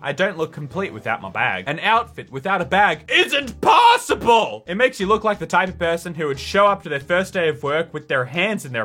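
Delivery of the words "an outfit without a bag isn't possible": loud, slow, high dramatic wail; mock dramatic cry. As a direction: overact the outrage.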